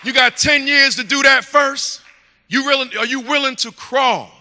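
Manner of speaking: shouted, slowing challenge to the crowd; preaching shout. Real, not acted. Preacher shouting a challenge at the congregation. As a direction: thunder the question at them.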